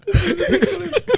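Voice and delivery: cracking up, high and loud; agree, then break into helpless laughter; laughing. Real, not acted. Friends joking over one baggage claim, real laughter.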